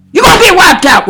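sudden loud, emphatic outburst; forceful warning. Real, not acted. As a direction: burst out with the warning. Warning repeated far more forcefully.

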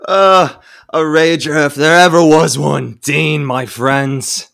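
loud tipsy slur; sloppy and merry drunk. Acted. Drunk Ed after party.

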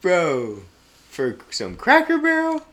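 high, loud disbelieving question; incredulous. Real, not acted. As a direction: ask it in total disbelief.

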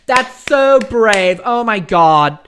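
high, loud, gushing praise voice; mock gushing editor. Editor gushing over a bad article, mock praise. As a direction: ham up a fawning editor. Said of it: "instead they probably went".

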